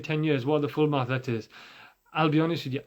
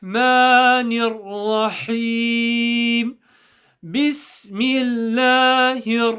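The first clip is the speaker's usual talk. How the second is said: higher, sustained chanting tone; Quranic recitation; 6 semitones higher, 4 dB louder, much slower than usual. Shown as a demonstration. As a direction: recite slowly with long held vowels. Recites the Tasmiyah.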